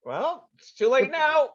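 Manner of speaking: smug gloating. Acted. Smug NPC taunting a captured player, gloating. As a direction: rub it in that they're doomed. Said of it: "he's looking pretty smug".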